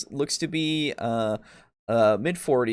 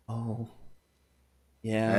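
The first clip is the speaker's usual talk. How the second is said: smile fading; 3 semitones lower, 7 dB quieter than usual. Acted. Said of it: "her smile definitely fades a bit".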